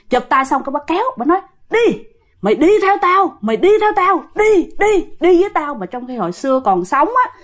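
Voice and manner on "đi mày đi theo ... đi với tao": high, insistent ghostly demand; fierce command. Retold or imitated. Ghost sister drags great-grandmother by the hand, fierce command. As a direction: order her along, urgently repeating it. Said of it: "mà dữ lắm nha".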